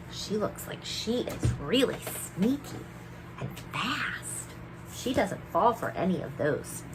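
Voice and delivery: whispered and soft. Real, not acted. Teacher whispering to children about the sneaky fairy. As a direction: whisper it secretly.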